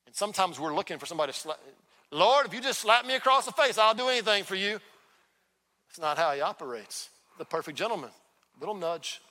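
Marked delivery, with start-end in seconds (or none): 2.1-4.8 high, loud mock plea; pleading believer. Believer begging the Lord for a slap, overeager. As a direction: cry out to God in exaggerated eagerness.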